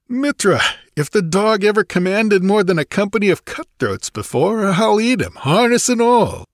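high-pitched, blustering mercenary; jovial soldier of fortune. A narrator's reading. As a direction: accept with devil-may-care swagger. Grinning captain agrees to serve.

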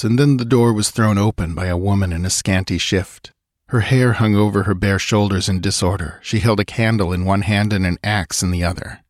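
ordinary delivery

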